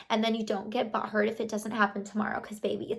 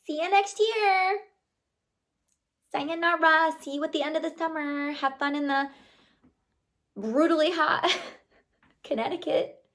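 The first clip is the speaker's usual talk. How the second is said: gleeful mocking goodbye; 7 semitones higher than usual. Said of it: "And I was like".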